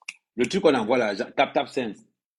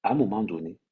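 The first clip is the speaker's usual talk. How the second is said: ordinary talk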